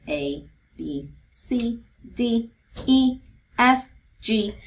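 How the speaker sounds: letters spaced out with clear pauses; slow, deliberate alphabet recital; one letter at a time. Shown as a demonstration. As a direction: recite each letter name separately, as if pointing along. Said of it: "really just tracking to make sure".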